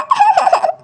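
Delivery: high acted sobbing wail. Daughter wailing to her mother, high sob. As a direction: wail in tears.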